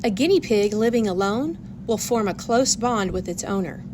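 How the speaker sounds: ordinary delivery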